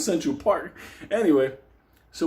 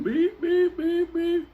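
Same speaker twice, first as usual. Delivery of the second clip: high monotone "beep" on a steady pulse; alarm clock beeping; 12 semitones higher, much slower than usual. Retold or imitated. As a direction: mimic an alarm clock, one even pitch. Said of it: "like a fucking alarm clock".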